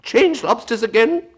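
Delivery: high yell at the top of the voice. A narrator's reading. Gryphon orders another lobster change, yelling. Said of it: "yelled the Gryphon at the top of his voice".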